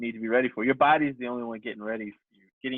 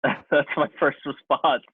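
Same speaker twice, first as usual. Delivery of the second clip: ordinary talk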